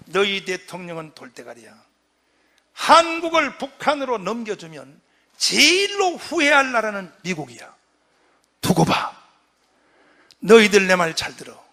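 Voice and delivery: booming scolding that builds to a loud end; thundering rebuke. Retold or imitated. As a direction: dress down the American envoys. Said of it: "하고 호통을 쳐요". (미국 협상단을 꾸짖는 호통)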